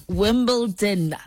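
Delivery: "Wimbledon" drawn out slowly and carefully. Real, not acted. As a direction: stretch each syllable of "Wimbledon". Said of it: "I need to say it slowly".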